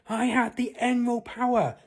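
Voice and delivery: high, loud heroic call; wife calls up her power; power-up shout. Acted. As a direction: announce it like a superhero.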